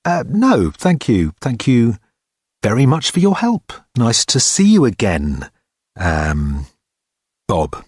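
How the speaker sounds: awkward thanks, trailing down to a guess; hazarding. A narrator's reading. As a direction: back out politely, unsure of the name. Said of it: "he hazarded".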